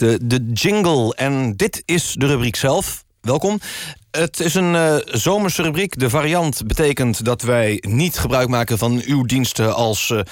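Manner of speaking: ordinary talk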